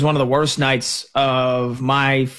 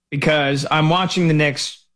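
ordinary delivery

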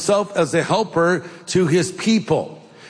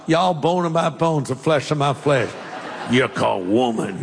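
lightly drawled Southern voice; Southern accent; 3 semitones lower than usual. Retold or imitated. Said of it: "if he said it like he was from the South".

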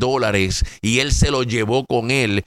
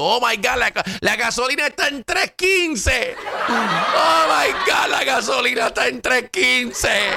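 mocking panicked voter; 11 semitones higher than usual. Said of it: "llorando porque la economía los estaba asfixiando".

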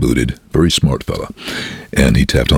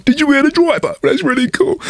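excited passenger voice. Retold or imitated.

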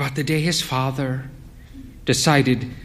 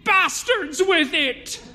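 much higher, slow hammed-up yell; called out line; slower than usual. Wife acting the grandmother's yell, hammed-up. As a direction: bellow the old family curse. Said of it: "reddened as she called the line".

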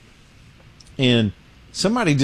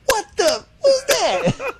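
shrill panicked squeal, much higher; startled high squeak; much slower than usual. Retold or imitated. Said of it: "And he goes".

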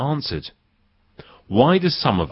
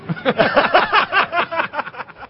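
laughter. Real, not acted. Laughing at being refused.

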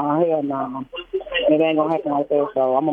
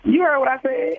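indignant retort; 6 semitones higher, slower than usual. Real, not acted. Quarrel over the other woman.

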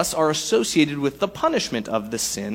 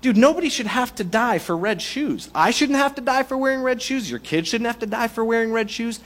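higher, incredulous pleading; exasperated protest; 6 semitones higher than usual. Pretend. Congregant objecting to the dictator, higher and incredulous. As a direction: protest it like it's absurd. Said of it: "'Cause you're gonna say".

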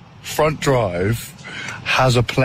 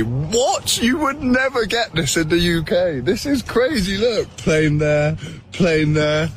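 amazed; 4 semitones higher than usual. Real, not acted. Brit amazed at air park.

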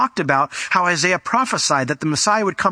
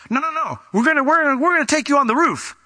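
much higher, excited insisting; eager friends; 11 semitones higher, faster than usual. Retold or imitated. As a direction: talk him into it, bursting with zeal.

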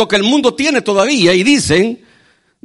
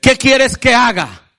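Saul's question; 5 semitones higher, slower than usual. Acted. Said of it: "la respuesta de Pablo".

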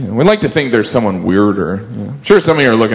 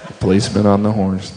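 ordinary delivery

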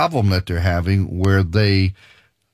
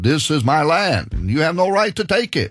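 mock claimant, higher and faster; claim of the Arabs; 6 semitones higher than usual. Preacher voicing the Arabs claiming their land, mock indignant.

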